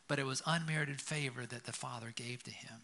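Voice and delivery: ordinary talk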